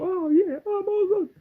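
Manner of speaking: very high, hammed-up London voice; mock London accent. Seller mimicking locals at the market, exaggerated London accent. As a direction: squeal it like a caricature Londoner. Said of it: "everybody sounds like they come from London".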